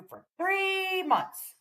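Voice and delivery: high, slow announcement; high drawn-out celebration; announcing. Acted. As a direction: sing it out like a hype host. Reading member message, celebrating.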